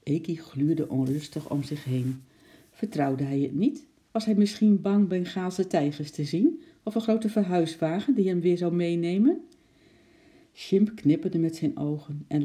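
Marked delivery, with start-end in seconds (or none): none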